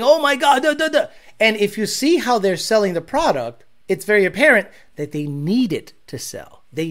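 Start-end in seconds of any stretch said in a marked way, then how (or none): 0.0-1.1 higher mocking gush; mock hype. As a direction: parody a salesman freaking out. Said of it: "I'm not out there saying".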